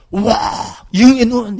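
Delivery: high, loud mock gasp of wonder; mock awed excitement. Teacher mimics students fawning over a star's eye, mock awe. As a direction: gush in exaggerated admiration.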